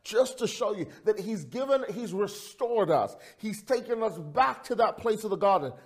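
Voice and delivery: high, driving sermon delivery; impassioned preaching. Real, not acted. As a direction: preach it with rising fervour.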